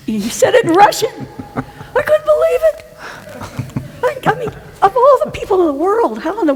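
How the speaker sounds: real delighted laughing squeal; laughing disbelief. Real, not acted. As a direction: break into incredulous laughter. Laughing disbelief retelling the surprise.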